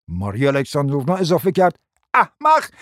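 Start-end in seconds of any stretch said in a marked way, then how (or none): 2.1-2.7 high, sharp cry; angry insult. Acted. Hostess joining insults against the prince, snapping angrily. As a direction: spit out the word loudly.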